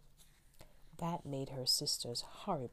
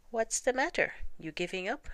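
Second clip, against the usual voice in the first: turtle voice, much higher and louder; teasing turtle; 8 semitones higher, 5 dB louder than usual. Acted. Turtle teasing the napping rabbit, high and taunting. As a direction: poke fun at the lazy rabbit.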